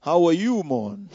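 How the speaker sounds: loud, cheery West Indian greeting; imitating a Barbadian church friend; West Indian accent. Retelling church friends' greetings in a West Indian accent. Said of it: "they speak with this West Indian accent".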